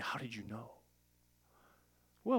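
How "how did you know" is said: hushed amazement. Retold or imitated. Convicted seeker's amazed reply.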